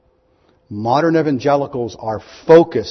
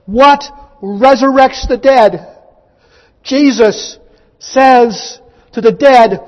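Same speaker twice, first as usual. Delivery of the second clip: high, loud, slow proclamation; full-force preaching; 10 semitones higher, 6 dB louder, slower than usual. Acted. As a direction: hammer it out word by word, pitched up. Preacher building to Jesus' shouted command.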